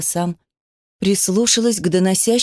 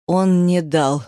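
plain reading voice

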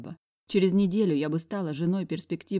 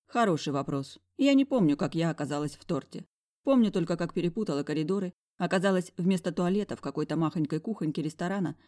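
plain reading voice

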